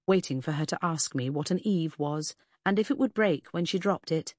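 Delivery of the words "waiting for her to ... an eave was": ordinary delivery, nothing marked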